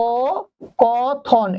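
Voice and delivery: কথোপকথন drawn out very slowly; slow spelling demo. Shown as a demonstration. Teacher writing কথোপকথন on the board for the class, very slowly. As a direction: stretch কথোপকথন syllable by syllable. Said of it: "এই যে এরকম হয়ে যাবে".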